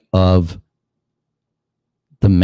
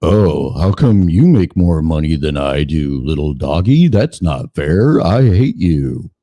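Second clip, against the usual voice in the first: mock whiny owner; 6 semitones lower than usual.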